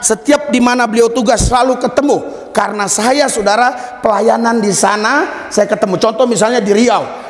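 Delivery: high, driving sermon delivery; impassioned preaching. Real, not acted. Preacher tells congregation of repeated meetings, high impassioned preaching.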